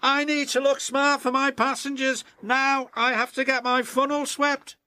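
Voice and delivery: high, fast, self-important engine voice; a show-off brushing off his friends; vain James. Acted. James refusing to help his friends, high vain voice.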